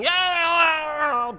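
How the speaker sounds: long, high-pitched yell mimicking a small boy; imitated child yelling. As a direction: yell it like a kid in the back seat.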